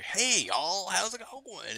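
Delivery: high, drawn-out Southern drawl greeting; Southern twang. Retold or imitated. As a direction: imitate a friendly, very Southern streamer. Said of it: "this most southern twang".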